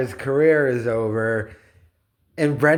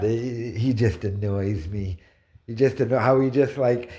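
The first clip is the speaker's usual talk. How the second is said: normal talking voice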